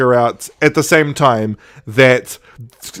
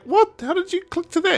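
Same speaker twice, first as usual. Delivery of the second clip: much higher startled question; shocked; 7 dB quieter than usual. Retold or imitated. Hannah exposed as the highwayman, shocked. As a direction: caught out and startled.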